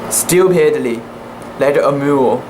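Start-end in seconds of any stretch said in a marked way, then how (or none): none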